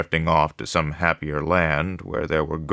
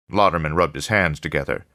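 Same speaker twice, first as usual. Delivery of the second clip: usual speaking voice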